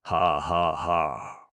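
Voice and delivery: loud hearty ha ha ha; mirthful laughter. A narrator's reading. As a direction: laugh doubled over. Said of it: "Goof doubled up with mirth".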